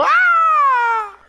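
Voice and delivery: high, far fan scream; mock fan shriek. Fan screaming at [name].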